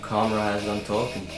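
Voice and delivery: ordinary talk, nothing marked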